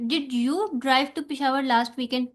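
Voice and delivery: you punched, sentence said very slowly; did you drive, leaning hard on you; stress on you. Shown as a demonstration. Teacher demonstrating emphatic stress on you, slowly. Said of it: "यू पे stress आ रहा है".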